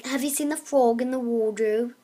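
put-on a light British accent on a stock phrase; mock British accent. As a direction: put on a schoolbook English accent. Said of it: "someone taught me to say".

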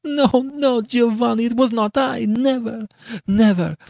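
very high, desperate protest; shrieking denial. A narrator's reading. Beatrice swearing her innocence to Giovanni, shrieking. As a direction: deny it frantically at the top of your range. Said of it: "shrieked Beatrice".

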